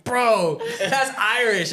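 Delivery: mock the friends laughing at the accent; a hint of a laughing Scottish friend; retold Scottish friends. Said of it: "they were like".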